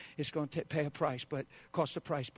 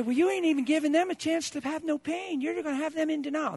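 much higher, mocking protest voice; mock objector; 12 semitones higher, 6 dB louder than usual. Pastor voicing a critic of his counsel, high mock protest. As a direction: play the whiny critic. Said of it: "You say".

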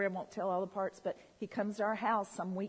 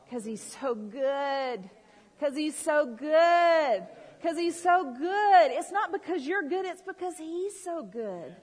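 slow, drawn-out cry rising higher and louder, then easing; fervent praise; 5 semitones higher, 6 dB louder, slower than usual. Real, not acted. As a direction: preach it with swelling awe. Preacher repeats praise with growing fervour.

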